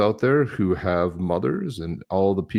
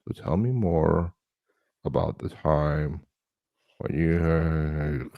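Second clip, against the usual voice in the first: slow, drowsy, softer interviewer voice; sleepy Lex Fridman; 3 semitones lower, 3 dB quieter, much slower than usual. Retold or imitated. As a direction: drag it out like he's half asleep. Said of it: "And this is Lex Fridman".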